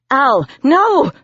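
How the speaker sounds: high, alarmed shout. A narrator's reading. Narrator to demon tapping the line, alarmed shout. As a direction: cry out to stop him. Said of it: "I shouted".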